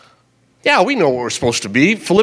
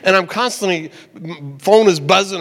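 ordinary talk, nothing marked

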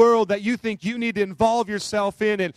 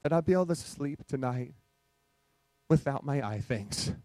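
gently imitating a small girl praying; a child's prayer; 5 semitones lower, 8 dB quieter than usual. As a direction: hush it like a child praying at bedtime. Child's bedtime prayer quoted.

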